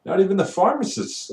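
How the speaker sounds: high, mocking snap back at a rude customer; imagine finally telling the customer off; exasperated retort. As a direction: pitch shoots up in disbelief as the question builds. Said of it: "and I'm like".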